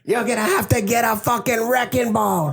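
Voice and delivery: lightly voiced Wolf of Wall Street impression; movie quote impression. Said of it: "it's a clip from Wolf of Wall Street".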